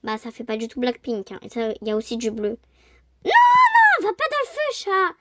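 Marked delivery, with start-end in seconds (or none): 3.2-5.1 « non, non » crié très aigu et très fort; cri d'alarme. Real, not acted. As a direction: crie pour arrêter le chat. Le chat va dans le feu. (crie au chat de ne pas aller dans le feu, cri paniqué très aigu)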